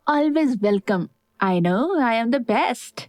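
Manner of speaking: drawn-out, self-satisfied brag; cocky, lingering delivery; playful attitude. A narrator's reading. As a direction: show off with stretched-out words. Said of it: "एकदम attitude दिखाते हुए बोली".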